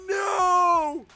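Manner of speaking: high, drawn-out moans of no; anguished wailing. Real, not acted. Gamer mourning the hit streak, anguished wail. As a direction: wail slowly in disbelief.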